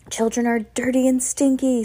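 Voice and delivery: understated scream of disgust; mild scream. A narrator's reading. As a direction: cry it out as a ranting witch. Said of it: "screamed the Grand High Witch".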